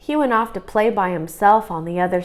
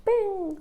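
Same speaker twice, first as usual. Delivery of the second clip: high little bing sound effect; mock ball sound; 13 semitones higher, 5 dB quieter than usual. A narrator's reading. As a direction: pop out a playful bing. Ball hits at party.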